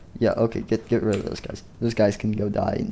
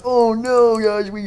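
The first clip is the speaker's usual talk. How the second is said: much higher, startled oh no; panicked cry; 10 semitones higher, slower than usual. Acted. Mangle getting trapped, crying out to the guys in panic. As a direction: cry out as the trap closes.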